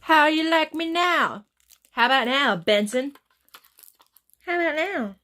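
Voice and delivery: loud, slow, sassy taunt; loud playful boast; showing off. Pretend. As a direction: flaunt it like you just got a makeover. Showing off new look.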